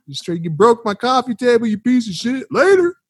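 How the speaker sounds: high, sneering put-on voice; mock angry rant. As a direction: taunt her and leave. Imagined dealer's rant at woman.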